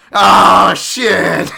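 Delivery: very loud, high drawn-out yell; outraged yell. Acted. Fan reacting to a bad matchup, outraged yell. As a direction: holler it out in dismay.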